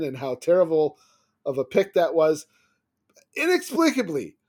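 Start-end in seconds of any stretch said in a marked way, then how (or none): 3.3-4.4 very high, falling exclamation of disbelief; incredulous. Real, not acted. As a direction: pitch it way up in bafflement, then let it fall. Incredulous at coach's behaviour.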